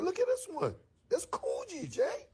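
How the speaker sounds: high, whiny insistence; excited. Retold or imitated. Urging his friend to see the label, high and excited. Pushing Jay to look again.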